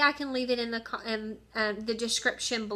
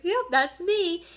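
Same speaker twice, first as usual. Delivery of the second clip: high, slow, proud yep; cheerful admission; 7 semitones higher, 4 dB louder, slower than usual. Retold or imitated. Answering the teacher's teasing, proud and cheerful. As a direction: own it brightly, drawing it out. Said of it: "And I was like".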